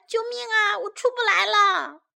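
put-on high wail of a trapped child; crying out. A narrator's reading. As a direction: call for help high and urgently. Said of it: "他突然又叫了起来".

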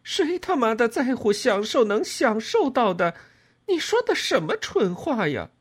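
high, loud, outraged yell; furious, scornful outburst; angry shouting. A narrator's reading. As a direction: lash out in a high-pitched shout. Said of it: "我对他叫喊着说".

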